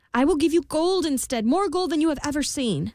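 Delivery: high, urgent plea; pleading queen. A narrator's reading. Queen begging the imp for her son. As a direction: beg to save the baby.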